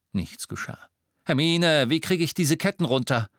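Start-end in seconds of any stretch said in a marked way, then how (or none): 1.3-3.3 high, loud call for help; urgent call. A narrator's reading. Harry calls for help.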